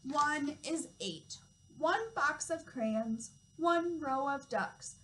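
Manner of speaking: slow, lilting read-aloud with each number drawn out; reading to children. Acted. Toddler teacher reading a counting book to children, slow storytime voice. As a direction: read it slowly and clearly for little ones.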